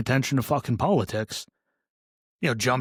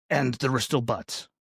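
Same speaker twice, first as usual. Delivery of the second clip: usual speaking voice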